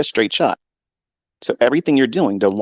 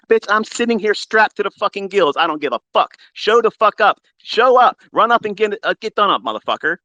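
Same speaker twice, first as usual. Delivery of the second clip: pitched way up, raging defiance; furious rant; 11 semitones higher than usual. Real, not acted. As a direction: shout down the people threatening him.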